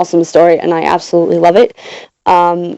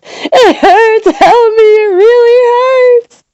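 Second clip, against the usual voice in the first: high, tearful screams of pain; wailing; 3 dB louder, slower than usual. A narrator's reading. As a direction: wail for help, stretching out the last cry. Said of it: "I wailed".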